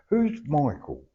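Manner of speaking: ordinary talk, nothing marked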